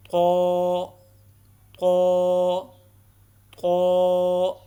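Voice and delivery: قو drawn out long and thick, repeated three times; drawn-out Quranic letter. Shown as a demonstration. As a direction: recite قو full-mouthed and stretched, like a qari. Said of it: "کیسے پڑھنا ہے؟". (قو کو موٹا اور لمبا کھینچ کر پڑھیں)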